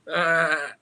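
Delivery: long imitated bleat; goat bleat. Goat who can do nothing now, bleating in complaint. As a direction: bleat it out helplessly. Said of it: "just being a goat out in the rain".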